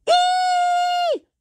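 very high, loud held mock scream; shrieked squeal. Shown as a demonstration. Storyteller showing children how Moncho screams. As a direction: shriek on one long high note. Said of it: "¿cómo gritas?".

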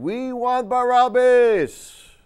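very high, slow, loud crowd demand; mob demanding. Acted. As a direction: shout it like the whole crowd. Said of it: "Everybody yelling".

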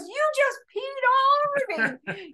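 high, mock outraged brother; angry older brother. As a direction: play the soaked brother, appalled. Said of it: "he goes".

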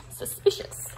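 breathy whispered aside. Real, not acted. Reader whispers to the children, suspicious hush. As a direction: hush it like a secret. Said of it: "whispered aside".

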